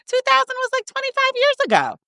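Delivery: very high-pitched mock voice; mimicked stylist. Stylist telling client how long ago it was, mimicked very high. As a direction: mimic her way up high, far above your own voice. Retold quote of the hair stylist.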